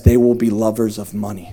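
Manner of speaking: usual speaking voice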